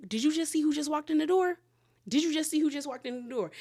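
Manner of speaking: high, rapid disbelieving question; mock-urgent, rushed and high-pitched; urgent gossip. Friend alerting Brittany who just walked in, urgent.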